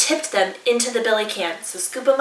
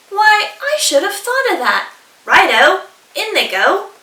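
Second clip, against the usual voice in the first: much higher, louder delighted; eager dingo; 8 semitones higher, 6 dB louder than usual. Acted. Dingo delighted with echidna's advice, high and loud. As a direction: slap your head and agree.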